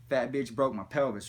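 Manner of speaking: normal talking voice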